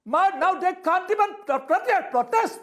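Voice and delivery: rising exasperation over the word protest; pleading disbelief, pitch up; indignant outburst. Real, not acted. Indignant panelist, farmers can't protest.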